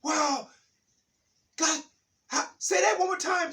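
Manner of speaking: high, loud, breathless joy; high, loud gushing delight; overjoyed. Retold or imitated. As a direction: gush like the best feeling ever. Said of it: "She said".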